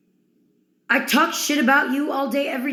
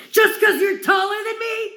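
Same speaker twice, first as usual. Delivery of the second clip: indignant shout; 9 semitones higher than usual. Acted.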